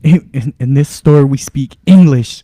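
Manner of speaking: hammed-up entitled shopper, drawn out; mock Karen. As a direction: customer scolding the cashier in a loud, slow mock voice.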